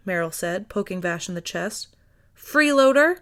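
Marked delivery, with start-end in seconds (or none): 2.3-3.2 louder playful accusation; teasing shouted insult; mock scold. A narrator's reading. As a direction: call him out loud, half-joking. Meryl scolding Vash.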